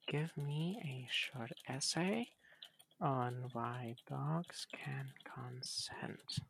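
hushed, slow whispered prompt. Real, not acted. Typing a naughty prompt to the chatbot in a slow whisper. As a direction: breathe the request almost silently, slowly. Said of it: "whispered joke prompt".

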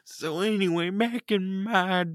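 put-on high, slow old-timer voice; creaky grandpa telling stories; very old faint voice. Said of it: "Can you stop talking like an old person".

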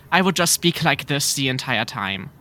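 exaggerated German accent, every sound overpronounced. Shown as a demonstration. Guest shows a faked accent to doubters, overpronounced German accent. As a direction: overdo the German accent word by word. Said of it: "like, overpronounce it".

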